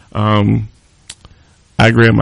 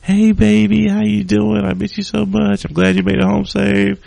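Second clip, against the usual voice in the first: put-on much higher, sugary girlfriend voice; sweet as pie; 11 semitones higher than usual. Angry date calls him next day all sweet, high cooing voice. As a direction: coo it like a sweetheart on the phone. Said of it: "sweet as pie on the phone".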